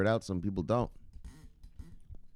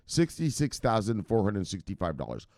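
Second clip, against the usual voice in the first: ordinary talk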